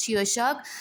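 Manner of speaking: ordinary talk, nothing marked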